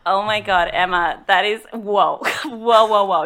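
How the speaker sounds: offended loud protest. Acted. Sea witch stopping Emma mid-claim, loud and offended. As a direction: stop her in outrage.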